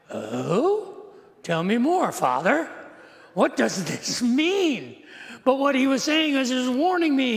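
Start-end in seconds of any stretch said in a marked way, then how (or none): 0.1-5.0 mock-earnest wide-eyed boy, rising and dipping pitch; naive young son. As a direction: play the awed kid asking Father, drawn out. Plays his naive younger self.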